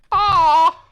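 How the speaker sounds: a sudden high, loud cat yelp; startled yelp. Acted. Puss yelping when his flirting is rebuffed. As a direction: yelp as if caught out.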